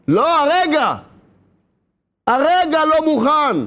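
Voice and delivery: high, drawn-out protest; adamant refusal. Acted. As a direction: refuse insistently, stretching the words high. Penitent refuses to give in.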